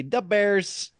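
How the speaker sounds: slow mock Chicago accent, "da Bears"; Chicago sports fan. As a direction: imitate a sketch-comedy Chicago superfan. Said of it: "Saturday Night Live".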